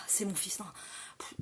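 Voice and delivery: hushed embarrassed aside; whispered aside. Acted. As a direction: whisper it away from the door.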